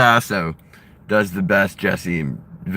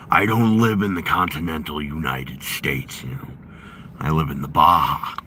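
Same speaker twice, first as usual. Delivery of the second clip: Jesse Ventura impression; 6 semitones lower, 3 dB quieter than usual. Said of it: "I've been working on my Jesse Ventura".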